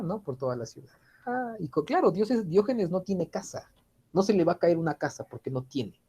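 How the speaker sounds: ordinary talk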